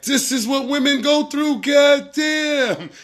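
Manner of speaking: high, put-on yelp of outrage; mock outraged complaint. Cold on the truck, hamming up his own complaint, high mock outrage. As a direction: whine like it's all women's suffering. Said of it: "I'm like".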